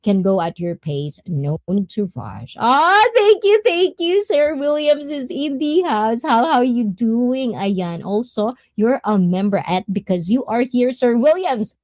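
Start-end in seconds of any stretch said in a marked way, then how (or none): 2.6-7.5 high-pitched, delighted shout-out; excited thanks. Real, not acted. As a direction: gush thanks and announce an arrival, high and lively. Thanking a member who just arrived.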